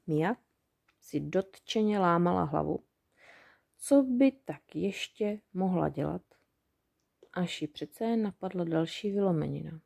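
usual speaking voice

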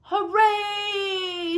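high, long-held hooray; acted shout without much volume; shouted cheer. A narrator's reading. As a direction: stretch it into a gleeful storybook cheer. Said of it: "shouted Larch".

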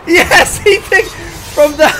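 overjoyed, laughing as you shout; elated cheering. Real, not acted. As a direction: cheer wildly at the comeback. Fighter suddenly turns fight around.

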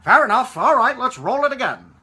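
loud, high director's holler; director calling out. Retold or imitated. Director agreeing and restarting the take, imitated shout. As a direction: shout to the crew to get rolling. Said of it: "So he said".

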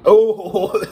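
sharp loud gagging oh; sudden gag. Real, not acted. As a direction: recoil from the smell. Caught a whiff, gagging.

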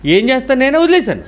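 high, resigned laughing tone; exasperated. Real, not acted. Preacher admitting defeat to a stubborn boy, exasperated. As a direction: throw up your hands in mock defeat.

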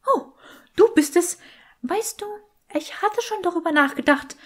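high, otherworldly skull voice; a sly magic skull teasing the boy; talking skull. Acted. Talking skull speaks to Draco. (hohe, fremdartige Stimme eines sprechenden Schädels)

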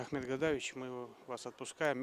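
normal talking voice

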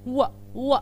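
"و، و" as a rounded w sound, repeated; isolated w sound; wh sound demo. Shown as a demonstration. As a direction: round the lips on "و، و" like a phonics card. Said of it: "دبليو اتش بيتنطق ازاي".

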